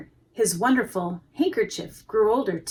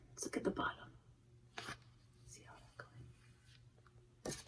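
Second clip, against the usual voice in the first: whispered aside; 25 dB quieter than usual. Real, not acted. Reader whispering to children about the picture. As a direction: lean in and whisper. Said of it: "whispered aside to children".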